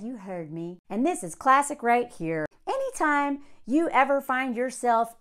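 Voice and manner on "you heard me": low cold threat. Retold or imitated. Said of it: "She's like".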